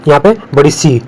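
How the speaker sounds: normal talking voice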